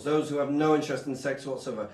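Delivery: ordinary talk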